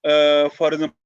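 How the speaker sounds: garbled, distant line just before cutoff; call dropping out. Real, not acted. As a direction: voice breaking up as the call drops. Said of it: "we lost him".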